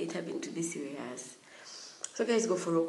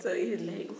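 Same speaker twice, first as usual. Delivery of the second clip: ordinary delivery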